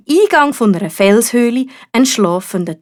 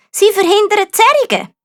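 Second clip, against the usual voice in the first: very high, squeaky knight; 12 semitones higher, 3 dB louder than usual. A narrator's reading. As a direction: squeak out the excuse nervously. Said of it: "erklärte Sascha". (sehr hoher, piepsiger Ritter)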